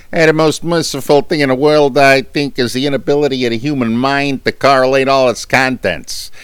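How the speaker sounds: loud, fast, silly cartoon voice on grim prose; Peter Griffin impression. Shown as a demonstration. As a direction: boom out Lovecraft like a goofy Rhode Island cartoon dad. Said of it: "I try to do Peter Griffin from Family Guy".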